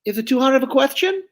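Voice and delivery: high, brash dummy voice; Joey the dummy. Shown as a demonstration. Dummy flirting with the lady down front, high and brash.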